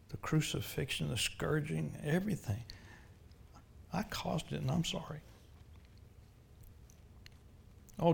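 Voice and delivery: quiet penitent prayer; led prayer. Real, not acted. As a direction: pray it humbly with them. Leads a prayer.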